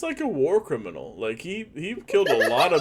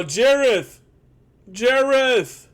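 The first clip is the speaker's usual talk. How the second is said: high, loud drawn-out call; 9 semitones higher, 5 dB louder, much slower than usual. Acted. Player's character summons Jareth from afar, calling out. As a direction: holler the name for someone out of earshot.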